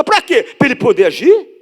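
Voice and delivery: ordinary delivery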